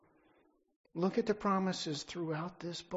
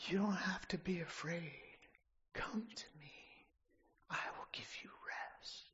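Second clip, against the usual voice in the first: tender whisper; Jesus whispering to the listener; whispered plea as Jesus; 8 dB quieter than usual. Acted. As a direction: breathe it like a gentle invitation. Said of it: "with tears in his eyes".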